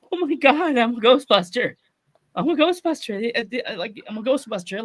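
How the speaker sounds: high-pitched re-enacted joyful disbelief; overwhelmed, tearful. Grown fan realizing he's a Ghostbuster, overwhelmed high voice. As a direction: imitate being choked up with amazement. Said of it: "I started crying".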